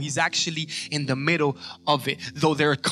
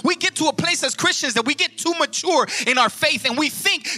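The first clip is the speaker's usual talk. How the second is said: high, loud, rising sermon cadence; fiery pulpit preaching; preaching at full force; 11 semitones higher, 6 dB louder than usual. Acted. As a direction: drive each line home to the congregation.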